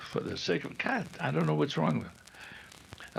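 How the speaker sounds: real exasperated aside, whispered under the breath; frustrated whisper. Real, not acted. Actor flubs his line, whispers in real frustration. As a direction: drop out of character and whisper to yourself in frustration.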